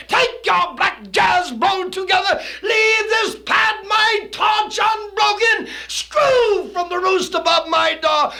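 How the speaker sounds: rising, loud hollering at the bird; furious tirade. Acted. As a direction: blast the raven off your door. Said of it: "He looks at the bird and he says".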